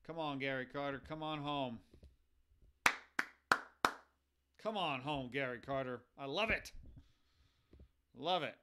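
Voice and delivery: loud, happy cheer. Real, not acted. Streamer welcoming his favorite player to the team, loud happy cheer. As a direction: welcome him home like a fan.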